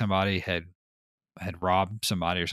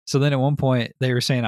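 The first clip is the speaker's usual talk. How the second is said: usual speaking voice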